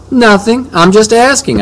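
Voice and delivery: high, playful innocent denial; high-pitched mock innocence; coy teasing. A narrator's reading. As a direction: play dumb, teasing her. Teasing repeat, much higher.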